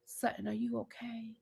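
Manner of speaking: an exaggerated whispery sympathy; mock soft concern. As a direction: lean in and murmur it. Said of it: "Kyle gonna lean over and say".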